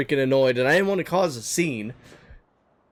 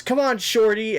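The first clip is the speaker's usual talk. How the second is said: higher, wheedling call, lightly voiced; imitating drunk woman; 7 semitones higher than usual. Woman calls the friends to dance, mock wheedling. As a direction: coax them like a pushy tipsy stranger. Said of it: "she looks at me and she goes".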